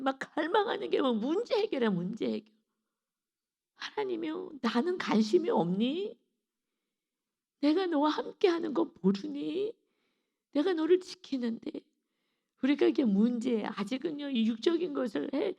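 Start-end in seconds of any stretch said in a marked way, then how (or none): 3.8-11.7 higher-pitched, wondering reproach; lightly voiced reproachful God. God asking believers who forget He is with them, mild reproach. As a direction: lift the pitch in mild appeal.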